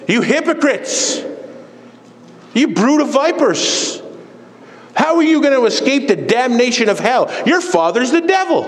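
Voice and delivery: Jesus's stern rebuke. Retold or imitated. Preacher quotes Jesus rebuking the hypocrites, stern denunciation. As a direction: denounce them as if from the pulpit. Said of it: "that's not very nice".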